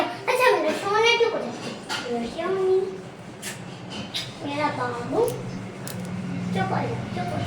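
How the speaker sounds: very soft, hushed comforting; soothing. Real, not acted. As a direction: gently hush a loved one who is upset. Soothing someone upset. (धीमे से पुचकारते हुए)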